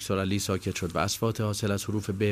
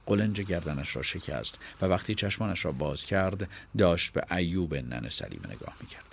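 plain reading voice

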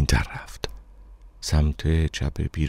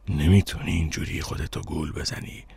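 softer, as if from a deep well; voice from deep away; 6 dB quieter than usual. A narrator's reading. Stranger warning the narrator against self-deception, softly from afar. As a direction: speak softly, as from the depths.

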